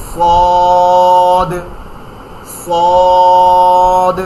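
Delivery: সদ said thick and loud with the tongue cupped; heavy sad. Shown as a demonstration. Teacher showing the thick letter sad, loud and heavy. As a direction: make সদ heavy, tongue shaped like a bowl. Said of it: "মোটা করে".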